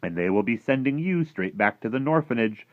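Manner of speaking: a hint of a fretful giant; lightly voiced friendly giant, worried. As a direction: lightly play the giant protesting.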